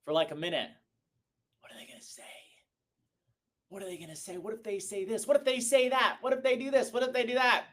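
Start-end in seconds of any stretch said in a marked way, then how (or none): 1.6-2.2 whispered worried inner voice; anxious whisper. Retold or imitated. Recruiter voicing his old fear before a call, whispered. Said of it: "I used to sit there and look at the phone". 3.7-4.4 nervous inner voice. Retold or imitated. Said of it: "I used to sit there and look at the phone". 4.4-7.7 spiralling worry. Retold or imitated. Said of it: "I used to sit there and look at the phone".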